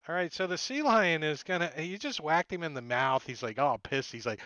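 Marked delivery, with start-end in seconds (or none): none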